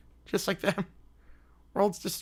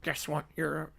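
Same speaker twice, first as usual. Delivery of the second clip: ordinary talk, nothing marked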